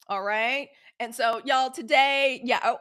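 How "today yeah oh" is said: normal talking voice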